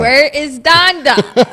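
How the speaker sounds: slow, loud mock lament; drawn-out wail. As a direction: drag it out like a whine. Echoing the joke, drawn out.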